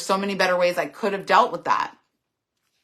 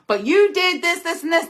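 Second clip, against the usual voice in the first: higher, mocking sing-song listing of faults; mocking blame list; 10 semitones higher than usual. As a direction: imitate someone shifting the blame in a mocking whine. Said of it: "It becomes almost like a mocking".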